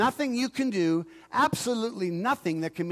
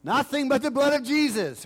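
call and response; 7 semitones higher, 5 dB louder than usual. Real, not acted. Preacher prompting Don with his favorite song, expectantly. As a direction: call it out high for an answer.

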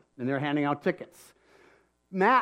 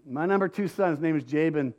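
normal talking voice

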